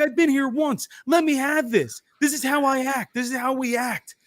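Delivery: high, insistent retold protest; pleading self-defence. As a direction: plead your case in a high voice. Acting out his self-defence by text.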